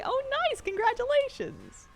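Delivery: very high-pitched, delighted cheer; gushing high-pitched congrats; excited congratulations. Real, not acted. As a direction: celebrate their win in a high, drawn-out squeal. Congratulating a viewer on a drop.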